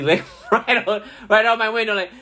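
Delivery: can't hold back the laugh; giggly disbelief. Real, not acted. Laughing while telling it.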